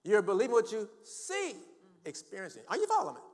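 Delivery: high, loud preacher's cry; impassioned pulpit shouting; full-force preaching. Real, not acted.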